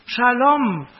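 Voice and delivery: loud, high Shalom called to the king; called-out greeting. Acted. Messenger loudly greeting the king: loud, high call. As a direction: hail the king from afar. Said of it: "Ahimaaz rief".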